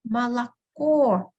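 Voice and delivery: slow "Молоко", ma-la-KO, weight on the end; stress on last O. Shown as a demonstration. Teacher showing the stressed letter, slow "Молоко" stressed on last O. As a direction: stretch "Молоко" and stress its final O. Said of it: "only the last letter O is stressed".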